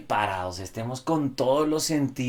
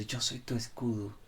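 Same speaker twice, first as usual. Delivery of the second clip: soft promise; 10 dB quieter than usual. Retold or imitated. Soft voiced promise of Jesus.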